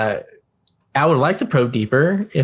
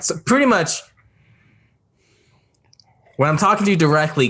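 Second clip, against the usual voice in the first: ordinary talk, nothing marked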